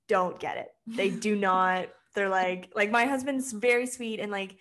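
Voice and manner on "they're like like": ordinary talk, nothing marked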